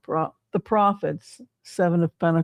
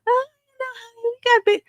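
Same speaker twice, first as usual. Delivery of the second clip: much higher, falsetto mock soothing; mock sweet-talking; much faster than usual. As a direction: fob her off in a silly high voice. Trump voiced soothing angry donor.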